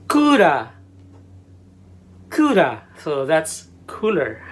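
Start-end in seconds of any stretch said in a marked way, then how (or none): 0.1-0.7 "Kura" high and loud, long koo, tapped ra; Japanese pronunciation. Shown as a demonstration. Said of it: "One more time". 2.3-2.8 "Kura" as koo-ra with tapped r, high and loud; Japanese pronunciation. Shown as a demonstration. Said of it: "One more time".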